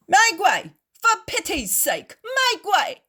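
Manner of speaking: high, loud call to clear the crowd; shout. A narrator's reading. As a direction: bellow it over the crowd, pleading. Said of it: "shout some bearers of a litter".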